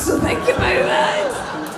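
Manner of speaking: mock shout. Real, not acted. Host scolds audience.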